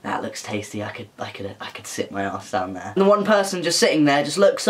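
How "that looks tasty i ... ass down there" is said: low, very soft voiced thought; low murmured musing. Eyeing a tempting spot to sit, low musing murmur. Acting out someone eyeing a seat.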